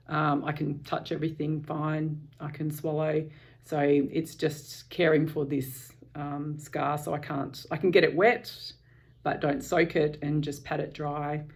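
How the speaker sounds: ordinary talk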